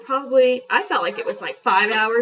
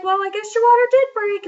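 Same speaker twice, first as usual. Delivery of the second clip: a higher, louder nurse voice, dry; mock nurse; 10 semitones higher, 6 dB louder than usual. Nurse teasing the leaking mother, higher and louder. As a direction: lightly play the nurse, teasing.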